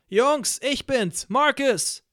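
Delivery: high, loud call to the cells. Acted. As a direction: announce yourself to everyone down the hall. Calling out to fellow prisoners.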